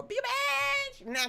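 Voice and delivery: high, long-held comic wail; drawn-out exclamation. Real, not acted. Telling viewers why attacks changed her, high drawn-out cry. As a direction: stretch it out way up high. Exaggerated drawn-out self-label.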